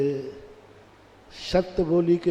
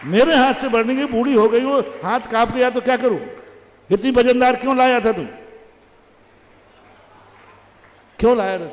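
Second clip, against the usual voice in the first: raised, louder, quick defiant mother; mother arguing back, fast and raised; mother's defiant retort; 5 semitones higher, 5 dB louder, faster than usual. Acted. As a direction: throw it back at the son. Mother argues with angry son.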